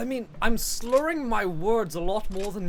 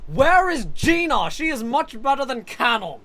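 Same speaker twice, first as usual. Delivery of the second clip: loud demand; 6 semitones higher, 5 dB louder than usual. Pretend. Demanding missing favorite character.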